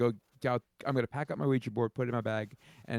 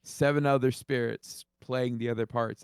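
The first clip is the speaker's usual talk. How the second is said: ordinary talk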